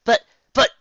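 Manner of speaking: high, loud sputter; flustered shout; stunned stammer. Acted. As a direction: sputter in disbelief. Twilight stunned.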